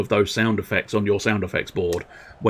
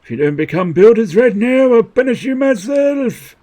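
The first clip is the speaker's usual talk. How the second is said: much higher, louder threatening royal voice; angry king; 10 semitones higher, 6 dB louder than usual. Acted. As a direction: bark the threat down at the subjects. King threatens his subjects.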